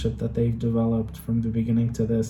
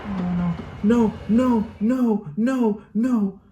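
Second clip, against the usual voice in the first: anguished denial; 6 semitones higher, much slower than usual. Real, not acted. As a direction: beg the screen in panic. Watching favourite character in danger.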